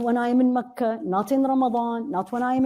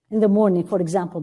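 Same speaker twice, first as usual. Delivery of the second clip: ordinary delivery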